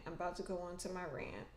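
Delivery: quick soft mutter to herself; muttered softly. Real, not acted. Vlogger warning viewers of a rant, muttered quickly. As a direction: drop it under your breath, fast.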